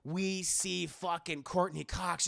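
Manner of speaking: normal talking voice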